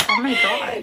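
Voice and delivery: high exasperated oh my God. Real, not acted. Reacting to a friend's story, exasperated. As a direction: throw up your hands.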